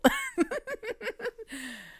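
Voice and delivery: a long high giggle of wonder; real giddy laugh; high delighted giggle. Real, not acted. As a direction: giggle in amazement. Amazed by the card again.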